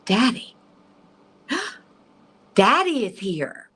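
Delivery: high, awed recognition with a gasp; slow, high-pitched, breath-catching wonder; gasping excitement. Retold or imitated. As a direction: imitate someone astonished to see their father. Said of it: "gasping and is so excited".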